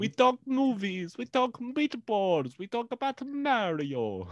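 a cartoonish Italian host drawing it out; bad Italian accent. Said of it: "we're like".

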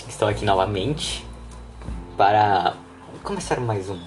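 voz grave e sensual; incubus. Pretend. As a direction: sedutora e baixa, bem devagar. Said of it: "Uou, voz sensual". (voz sensual de íncubo)

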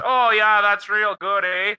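hammed-up Canadian accent. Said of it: "That's a pretty sick accent".

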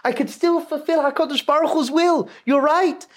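high, loud, beaming exclamation; joyful realization. Retold or imitated. Elimelech to Zusha in jail, suddenly joyful and loud. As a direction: burst out happily, smiling. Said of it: "he starts to smile".

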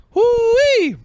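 very high, loud whoop; comic whoop. Acted. Comic caps off his own bit, high mock whoop. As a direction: let out a squealed "woo-wee".

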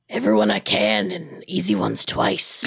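put-on low, creepy old man voice; a low leering growl; creepy old guy voice. Old guy's creepy line quoted.

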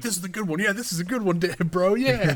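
high-pitched giddy quoted excitement; light, high voice bubbling with approval; excited fanboy. Retold or imitated. Watching goofy moves with a brother, giddy and high. Acts out excited sibling reaction.

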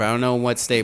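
usual speaking voice

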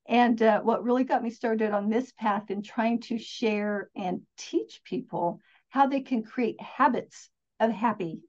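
ordinary talk, nothing marked

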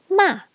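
"ma" starting high, falling sharply; high falling fourth tone. Shown as a demonstration. Teacher introducing fourth tone, "ma" high and falling. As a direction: drop "ma" quickly from high pitch. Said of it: "This is a high falling pitch".

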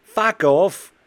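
loud, high Aussie brush-off; Australian accent. Retold or imitated. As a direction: snap it off in a strong Australian accent. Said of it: "what's the Australian accent?".

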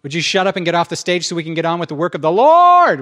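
hammed-up fed-up shout; acted impatient outburst. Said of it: "was how I felt".

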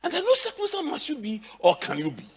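preaching. Real, not acted. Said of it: "Oh, am I preaching?".

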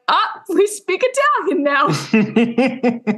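slow, stretched mock phone voice, laughing; drawn-out goofy robot-phone line through a laugh; talking phone. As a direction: play the phone as a gadget that suddenly goes Italian. Voicing the phone switching languages.

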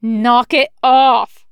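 loud, slow, emphatic command; scolding order. A narrator's reading. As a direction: drag out each word as a reprimand. Snag scolds the panicking rookie.

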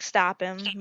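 ordinary talk

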